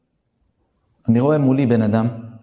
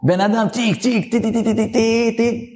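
smooth shofar blasts; 8 semitones higher than usual. Retold or imitated. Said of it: "חלקות".

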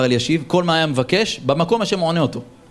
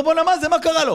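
startled question; 11 semitones higher than usual. Acted. Visitor shocked at head-banging.

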